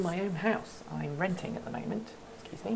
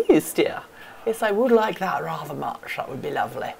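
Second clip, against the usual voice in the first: posh queenly reply; 2 semitones higher, 7 dB louder than usual. Acted. Replying to a chat question about a royal birthday, in a loud posh regal voice. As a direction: answer like a gracious queen granting a favour.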